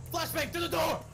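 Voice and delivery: high-pitched raid callout; mock SWAT shout. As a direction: yell it like a playful raid.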